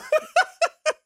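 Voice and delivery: cohost's high-pitched cackle at the joke; high squealing laugh. Real, not acted. Cohost cracks up.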